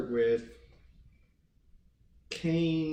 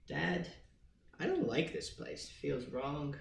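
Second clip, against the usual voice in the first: put-on softer, lightly raised child's voice; a hint of an uneasy kid, trailing softer; timid little boy; 3 semitones higher, 4 dB quieter than usual. Timid son answers his father.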